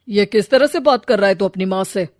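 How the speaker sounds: loud stern rebuke; father scolding son over mother. Acted. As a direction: bark at the son.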